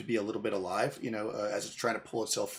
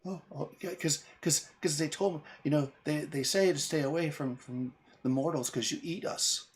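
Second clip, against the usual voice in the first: put-on timid fey girl; 4 semitones higher than usual. Fey girl explains her fear.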